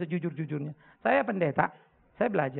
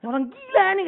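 high, loud mock exclamation; imitating alarmed driver; 12 semitones higher, 6 dB louder than usual. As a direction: exclaim as another startled motorist. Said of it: "Yang sana melintir-melintir juga tuh mobil". (meniru pengemudi lain yang kaget, tinggi dan keras)

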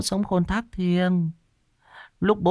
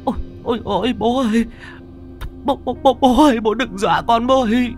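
terrified; 14 semitones higher, faster than usual. Acted. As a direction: scream at the ghost crawling out. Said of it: "Thị Kim kinh hãi".

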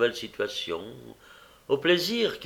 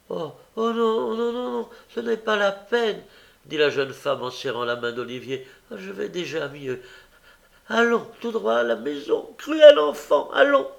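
high, wavering, then a loud shrill reproach; fake scolding sister; 10 semitones higher, 5 dB louder than usual. Young woman drags the boy home, scolding him loudly. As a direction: play the hurt sister for the crowd.

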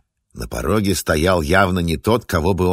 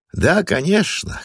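bloodthirsty grin; 13 semitones higher, slower than usual. Acted. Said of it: "кровожадно улыбнулся Олег".

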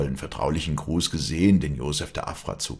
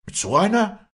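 very high, louder echo question; indignant shriek; 7 dB louder than usual. A narrator's reading. Insulted girl echoing her rival, shrieking. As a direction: shriek it back, stung by the insult. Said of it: "schrie das Mädchen".

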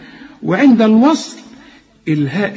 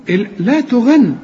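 ordinary talk

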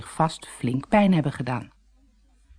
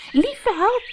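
much higher startled exclamation; surprised cry. A narrator's reading. Doortje cries out on hearing the woman is a witch. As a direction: gasp it out in surprise. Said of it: "riep Doortje".

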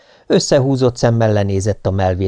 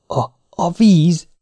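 high, slow, shaken stammer; frightened stammer; 8 semitones higher, slower than usual. Acted. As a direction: barely get the words out in fear. Terrified sergeant stammers. (magas, lassú, rémült dadogás)

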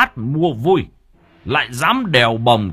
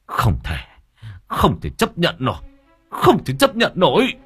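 extremely angry; 6 semitones higher than usual. A narrator's reading. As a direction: rage building with each repeat to a high outburst. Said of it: "chú đang vô cùng tức giận". (tức giận, gằn rồi gào lên)

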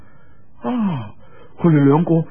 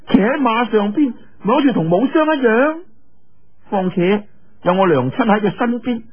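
put-on high bright-pitched girlish voice, teasing; playful young girl calling out; 7 semitones higher than usual. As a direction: call to him like an excited young girl. Yue Lingshan arguing with Lin. (岳靈珊高聲嬌俏咁叫林仔)

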